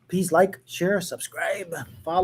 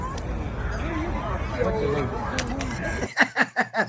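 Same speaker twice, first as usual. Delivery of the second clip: long real laugh; 4 dB quieter than usual. Real, not acted. Laughs at clip he shared.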